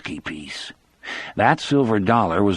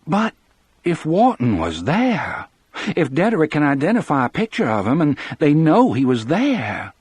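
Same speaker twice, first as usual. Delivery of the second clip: higher-pitched, pleading insistence; insistent objection, voice raised in pitch; urgent protest; 8 semitones higher than usual. A narrator's reading. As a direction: press the argument, pitch climbing. Wife argues against the men's doubts.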